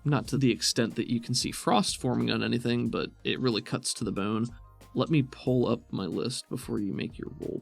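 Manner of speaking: ordinary talk, nothing marked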